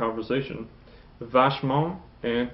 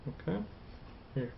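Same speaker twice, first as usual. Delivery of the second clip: usual speaking voice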